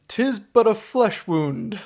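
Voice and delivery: defiant knight. Acted. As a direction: slowly declaim it like a comic knight. Quoting the film's knight.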